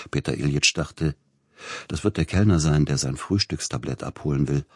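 usual speaking voice

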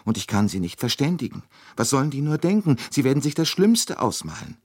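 a hint of weeping in the worried words; tearful. A narrator's reading. Swedish woman frets about her sister, mildly tearful. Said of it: "sagte die Schwedin weinend".

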